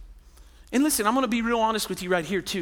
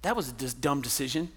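low, softer scornful mutter under the breath; inner grumbling; 5 semitones lower, 10 dB quieter than usual. Retold or imitated. Wife silently judging her husband, a low scornful mutter. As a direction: grumble it to yourself, not meant to be heard. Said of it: "in your heart you start going".

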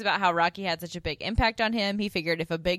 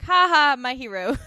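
loud mocking laugh, then cooing; 6 semitones higher, 7 dB louder than usual. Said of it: "she's like".

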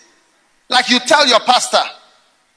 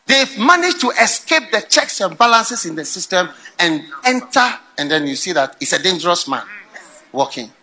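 ordinary delivery

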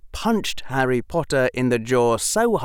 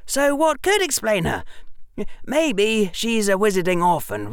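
put-on earnest boy lecturing, higher pitch; a patient know-it-all boy; young Harry voice; 12 semitones higher than usual. Harry explaining to Draco.

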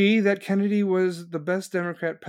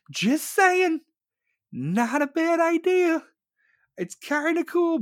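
high, sing-song faux innocence; mock innocent; 14 semitones higher, slower than usual. Host mocking innocence after a violent remark, high sweet voice. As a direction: play it sweet and innocent, like you don't mean it.

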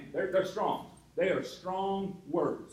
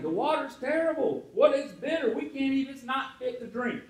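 complaining people; 6 semitones higher, 3 dB louder than usual. Retold or imitated. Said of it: "the people are complaining".

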